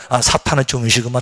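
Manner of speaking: low knowing tone; calm low recognition; modelled line. Shown as a demonstration. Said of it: "따라합시다".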